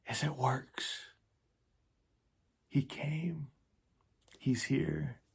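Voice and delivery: soft secret whisper. Acted. Poet's quote shared with viewers, hushed whisper. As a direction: breathe the words like a secret. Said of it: "whispered secret of the pet name".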